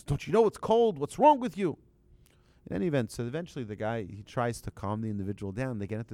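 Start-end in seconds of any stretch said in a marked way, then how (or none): 0.0-1.8 high, loud mock-angry rebuke; indignant scolding. Train passenger scolding a stranger for opening the window, indignant. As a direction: scold him in a raised, higher voice.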